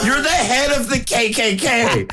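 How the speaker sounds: high mocking yell at a clueless bigot. Friend mocks a Klan member's outrage, high mock voice.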